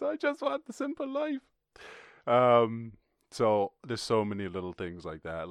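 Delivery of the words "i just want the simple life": high, dreamy cow voice; wistful cow. Retold or imitated. A cow dreaming of the field, high wistful voice. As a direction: sigh it like a cow that wants peace.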